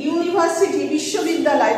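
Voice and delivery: usual speaking voice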